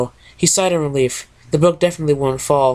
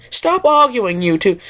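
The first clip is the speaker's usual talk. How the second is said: much higher, prim Rarity scolding the pair; a lady's sharp scold, pitched way up; Rarity yelling; 7 semitones higher than usual. Acted. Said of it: "yelled Ri".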